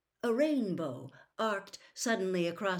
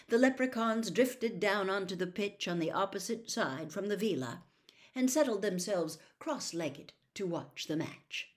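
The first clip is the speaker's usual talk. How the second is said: ordinary delivery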